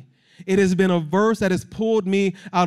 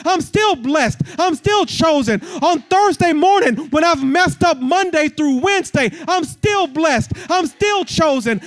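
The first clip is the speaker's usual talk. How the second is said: raised, driving sermon voice; impassioned preaching; 8 semitones higher, 4 dB louder than usual. Real, not acted. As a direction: preach it with rising fervour to the whole room. Preaching at full force.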